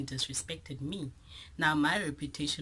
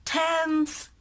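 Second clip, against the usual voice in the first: high, loud, stretched squeal; squeaky drawn-out tense; 10 dB louder than usual. Retold or imitated. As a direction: squeak the word out in mock panic. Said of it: "So I'm like".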